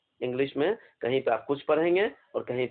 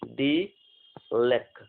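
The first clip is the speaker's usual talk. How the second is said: very slow, drawn-out syllable for dictation; much slower than usual. Shown as a demonstration. Teacher dictating to students, very slowly. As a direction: stretch each sound out for writing. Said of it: "dictate कर लें इसको".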